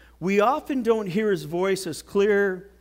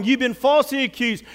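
normal talking voice

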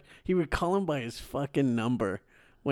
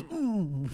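mock hum; 5 semitones higher, 4 dB quieter than usual. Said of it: "And he goes".

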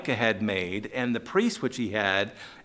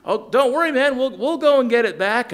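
higher, louder breezy reassurance; voicing the neighbors; 10 semitones higher, 6 dB louder, faster than usual. Preacher voicing neighbors promising Micah to get his stuff back, bright and loud. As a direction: toss it off high and loud, no problem.

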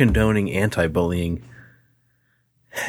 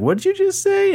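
much higher, incredulous challenge; mock outrage. Standing up to the bully, a high incredulous challenge. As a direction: squeak it out in disbelief. Retold fight, his own challenge.

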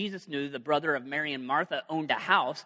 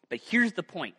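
ordinary talk

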